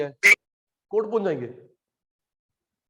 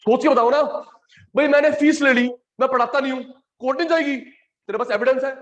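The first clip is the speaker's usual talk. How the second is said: exasperated push; 7 semitones higher, 4 dB louder than usual. Real, not acted. Teacher pressing students to answer, louder and exasperated. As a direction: come on, think, raised in exasperation.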